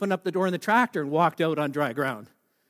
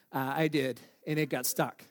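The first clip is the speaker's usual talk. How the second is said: low, softer admission; small, lowered voice, retold; sheepish confession; 5 semitones lower, 6 dB quieter, slower than usual. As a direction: own up reluctantly to dad.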